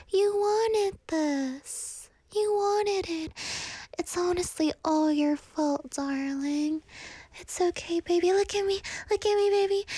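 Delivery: put-on high, eerily insistent girlfriend; Nikki. Toxic girlfriend blaming her darling, high and sweetly menacing. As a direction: coax and blame in a high, held voice. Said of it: "You know how she keeps saying".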